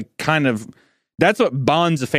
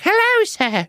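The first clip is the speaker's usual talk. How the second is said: put-on very high, sugary child voice; creepy polite child; slower than usual. Creepy well-mannered boy greets adults, in a very high voice. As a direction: play the eerie overly polite kid. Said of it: "Children of the Corn creepy".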